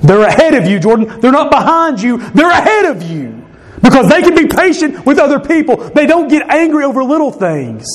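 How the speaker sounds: high, insistent self-scolding; emphatic self-rebuke. Acted. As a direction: drive the correction home to yourself. Scolds himself by name.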